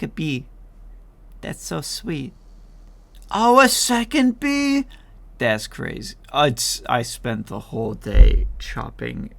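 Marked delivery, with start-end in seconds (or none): 3.3-4.9 surprised. Real, not acted. Surprised at bee.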